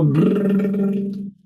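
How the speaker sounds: buzzing lip trill demo. Shown as a demonstration. Voice teacher demonstrating a lip trill for students. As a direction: trill the lips on a steady tone. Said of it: "So if I go".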